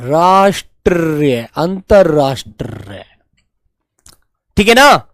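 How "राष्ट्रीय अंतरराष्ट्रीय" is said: slow spelling out. Shown as a demonstration. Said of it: "अब राष्ट्रीय इसके ऊपर लगेंगे".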